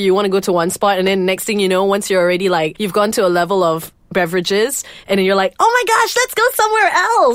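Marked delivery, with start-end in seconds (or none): 5.6-7.3 excited high squeal. Retold or imitated. Tipsy friend suggesting leaving.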